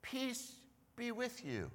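a hint of Jesus, soft and calm; gentle greeting. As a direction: soothe the frightened room. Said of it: "And he says".

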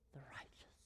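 "the righteous" breathed as a whisper; hushed whisper. Real, not acted. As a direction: drop to a whisper to land it. Preacher drops to whisper.

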